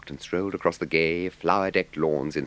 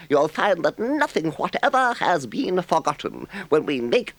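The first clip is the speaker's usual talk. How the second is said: high, slow, gushing toad voice; a much higher, delighted voice; boastful Toad; 4 dB louder, slower than usual. A narrator's reading. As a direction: gush over the visitors. Toad's lines.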